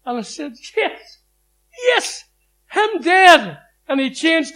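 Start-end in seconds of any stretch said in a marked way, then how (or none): 0.8-3.6 crying out. Retold or imitated. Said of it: "I started to cry".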